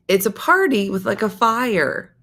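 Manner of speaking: imitated explaining lady. Retold lady explaining bush party.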